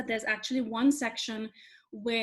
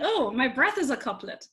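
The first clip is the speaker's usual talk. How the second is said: retold discovery, higher and louder; sudden realization; 4 semitones higher, 7 dB louder than usual. Speaker recounts spotting the couplet to listeners, delighted realization. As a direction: act out the moment it dawned on you.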